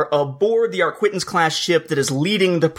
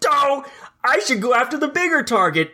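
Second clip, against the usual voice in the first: mock moron voice; 8 semitones higher than usual. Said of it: "him just being a moron".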